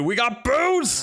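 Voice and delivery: high, slow triumphant cry; excited cheer. Acted. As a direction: cheer like a kid. Cheering after the gift.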